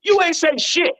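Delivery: high, loud incredulous retort; a sharp high retort to the rude clerk; mock exclaim. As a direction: throw it back at her, incredulous. Retold standoff at passport control.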